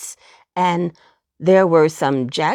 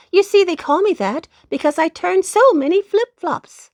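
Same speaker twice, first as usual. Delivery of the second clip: high, bright little-dog cry; poodle exclaiming; 9 semitones higher, 3 dB louder than usual. A narrator's reading. Poodle dog finishing tricks for the rabbit, high and proud. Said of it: "was the answer".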